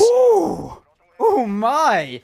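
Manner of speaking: a high, loud, drawn-out ooh of delight; delighted surprise. Real, not acted. Caster hearing a co-caster's fun nickname, delighted surprise. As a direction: gasp with glee at the nickname.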